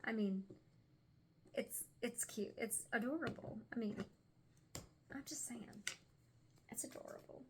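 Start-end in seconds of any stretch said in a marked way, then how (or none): 5.1-7.4 hushed, almost breathless aside; whispered aside. Real, not acted. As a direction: lean in and whisper it like a secret. Hushed aside about cute deck.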